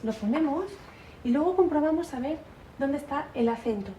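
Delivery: ordinary delivery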